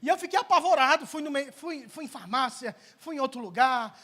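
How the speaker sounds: high-pitched, urgent storytelling; pregação exaltada. Acted. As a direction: recount a frantic search, voice pushed up high. Preaches story at full force. (relato aflito e exaltado, em tom agudo)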